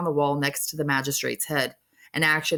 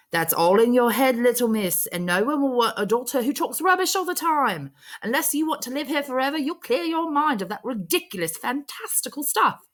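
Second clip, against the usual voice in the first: stern warden; 9 semitones higher than usual. Acted. Warden scolding orphan Alice for Wonderland talk, high and stern. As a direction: look down on the girl and scold her.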